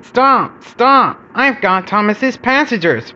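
high, urgent cry to stop; wailing call. A narrator's reading. Bertie calls after the departing train, wailing. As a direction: wail it out, pitched well up. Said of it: "wailed Bertie".

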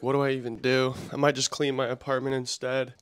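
ordinary talk

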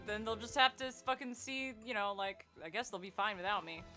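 ordinary delivery

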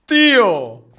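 loud shout across the game; calling out. Real, not acted. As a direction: yell "¡Tío!" to a friend. (grito fuerte de saludo)

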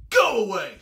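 loud, gruff-tempered ladybug shouting; loud story-time shout; grouchy shout. A narrator's reading. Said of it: "shouted the grouchy ladybug".

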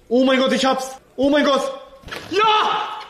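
high, loud, thrilled outburst; excited. Real, not acted. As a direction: cheer at the win. Balloon knot finally succeeds.

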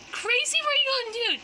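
high, loud, fast alarmed cry; high fast panicked outcry. Acted. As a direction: blurt it in panic. Rescued girl alarmed at plan.

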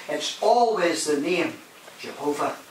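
ordinary talk

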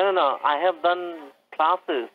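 ordinary talk

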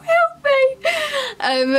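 high, crying plea; panicked cry for help. Retold or imitated. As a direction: wail it out in a panic. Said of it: "I was like".

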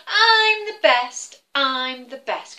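chanting I'm the best, proud and slow; boastful eagle. Acted. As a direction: crow it out like a show-off eagle. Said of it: "he would say".

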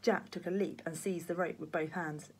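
ordinary delivery, nothing marked

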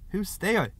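cold challenge from inside; unfeeling. A narrator's reading. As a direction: ask it without any sympathy. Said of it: "the same unfeeling voice said".

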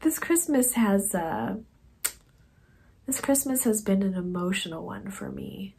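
real tears welling up as she pushes the words out; choked-up catch that breaks and fades to a hush; teary, choked up. Real, not acted. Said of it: "Now I'm getting teared up again".

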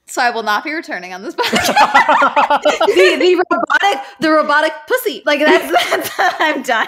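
laughing while protesting. Real, not acted. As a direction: crack up as you speak. Laughing at the clip.

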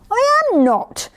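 high, indignant little tiger; indignant protest. A narrator's reading. Little tiger contradicting his teasing brother, indignant. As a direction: protest hotly in a small high voice.

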